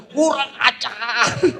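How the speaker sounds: high, slow yell through laughter; laughing mock angry yell. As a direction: yell it again half laughing. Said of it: "mendingan di lapangan sana teriak".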